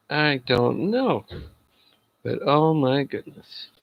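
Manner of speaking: usual speaking voice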